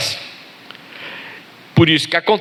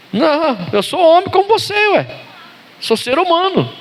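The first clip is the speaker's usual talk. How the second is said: playful protest; 10 semitones higher than usual. Acted.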